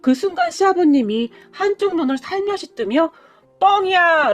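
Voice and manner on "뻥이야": playful shout. Acted. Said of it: "라고 외쳤어요".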